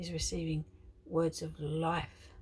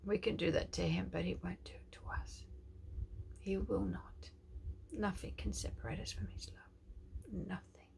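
hushed, intimate reassurance; soft whisper; 6 dB quieter than usual. Real, not acted. Preacher whispers to hurting viewers that God stays, softly. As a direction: whisper to comfort them.

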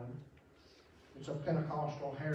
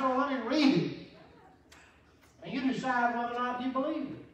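full force preaching; 9 semitones higher, 5 dB louder than usual. Acted. Preacher telling the congregation the Bible story, in full cry. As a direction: lean into the gospel story.

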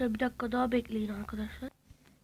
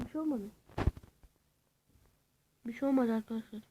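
ordinary delivery

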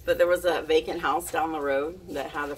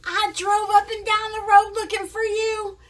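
high, raised mock-upset mom voice; 6 dB louder than usual. Mom who drove around searching, scolding her daughter. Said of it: "She was like".

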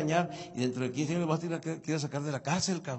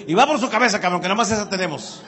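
much louder mock yell from old-school dad; angry dad yell; 2 semitones higher, 8 dB louder, faster than usual. Headless father yells at son, hammed-up shout. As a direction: bellow it like a furious father. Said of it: "así sin cabeza me hubiera dicho".

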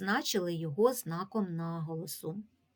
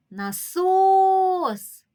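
насос called high and loud, second syllable stretched long; loud sustained stress on СОС in насос; calling out the word; 11 semitones higher, 9 dB louder than usual. Shown as a demonstration. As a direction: call out насооос, leaning on the last syllable. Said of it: "Якщо ми погукаємо слово насос".

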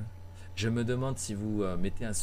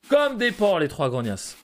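mock indignation; 8 semitones higher, 7 dB louder, slower than usual. Real, not acted. Insulting messy customers.

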